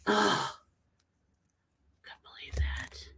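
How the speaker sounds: whispered disbelief; stunned whisper. Real, not acted. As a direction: breathe it out in shock. Shocked by mom's remark.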